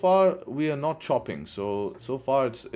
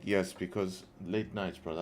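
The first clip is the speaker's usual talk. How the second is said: yawning; 3 semitones lower, 6 dB quieter than usual. Real, not acted.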